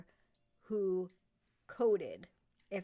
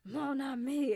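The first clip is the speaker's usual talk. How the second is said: loud, high, furious French "Mon ami"; angry French accent; 6 semitones higher, 9 dB louder, slower than usual. Retold or imitated. Auditioning for a children's book, an angry French accent. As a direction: bark it like an enraged Frenchman. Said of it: "And I, like, sounded so angry".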